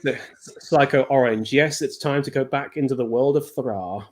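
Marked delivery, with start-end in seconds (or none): 3.5-4.1 "Thra" with a rolled R. Real, not acted. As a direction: roll the R in "Thra". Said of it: "I like how you roll the R on Thra".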